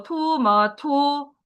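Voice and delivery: 토마토 drawn out very slowly, syllable by syllable; slow reading demo. Shown as a demonstration. Teacher reading 토마토 slowly to students, deliberate. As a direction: spell out 토마토 slowly for the class. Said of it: "세 번째 거".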